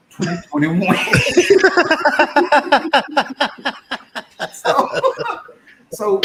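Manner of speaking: loud high laugh bursting out; real laughter. Real, not acted. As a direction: crack up on the line. Laughing at own punchline.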